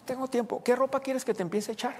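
high, sugary offer to help; sweetly helpful wife. Retold or imitated. Wife cheerfully offers to pack for her leaving husband. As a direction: play the wife all kind and unbothered.

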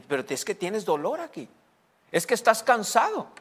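mock complaint, climbing high at the end; cuerpo que se queja. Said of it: "el cuerpo te grita". (voz aguda del cuerpo que se queja)

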